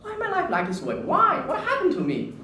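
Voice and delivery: high, pleading cry of despair; despairing why. Retold or imitated. Alone in the hospital, asking why, high and despairing. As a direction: ask the sky why, anguished. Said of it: "I start thinking".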